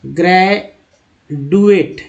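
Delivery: ग्रेजुएट said slowly as "gre-dueT" with a retroflex ड; with a hard D. Shown as a demonstration. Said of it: "पहले ड, फिर द, फिर ज उच्चारण करेंगे".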